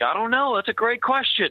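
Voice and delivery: high-pitched imitated non-answer; mock clueless answer. Captain imagining his reply to a grieving sergeant, high mock cluelessness. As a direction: pitch it way up, as a helpless dodge. Mimics an answer he can't give.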